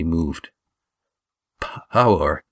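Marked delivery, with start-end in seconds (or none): 1.6-2.4 shaking voice. A narrator's reading. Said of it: "said Damon in a shaking voice".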